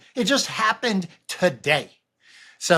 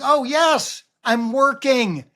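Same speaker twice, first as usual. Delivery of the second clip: excited realization; 7 semitones higher, slower than usual. Retold or imitated. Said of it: "I was like".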